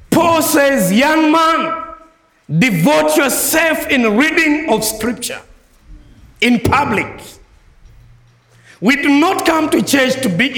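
preacher voicing Paul to young men, full force; fervent preaching. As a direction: thunder Paul's charge. Climactic quoting of Paul.

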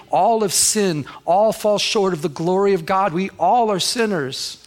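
preaching. Real, not acted. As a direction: drive it home to the congregation. Preaching at full force.

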